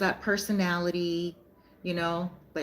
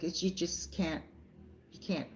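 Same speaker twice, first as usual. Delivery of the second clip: choked up; 3 semitones lower, 12 dB quieter than usual. Retold or imitated. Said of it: "she couldn't say".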